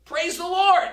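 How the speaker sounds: very high, loud shout of delight; lady finding the groceries on her porch, shouting for joy; joyful shout. Retold or imitated. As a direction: burst out with thanks. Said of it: "and she shouted".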